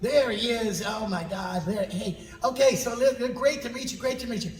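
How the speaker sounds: high, excited gushing; Mark Hamill. Retold or imitated. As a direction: greet a hero in a high, delighted voice. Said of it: "That's my Mark Hamill, by the way".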